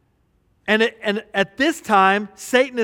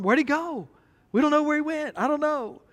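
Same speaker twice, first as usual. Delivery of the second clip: confused disciples; 6 semitones higher, 4 dB quieter than usual. Acted.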